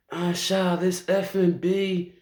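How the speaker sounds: high-pitched, drawn-out retold complaint; imitating complaining niece. Niece calling her aunt at night, imitated high-pitched complaint.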